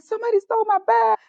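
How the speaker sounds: high, rushed retold panic; acting out a frantic freak-out. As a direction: blurt it in a mock-panicked high voice. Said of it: "So I'm freaking out".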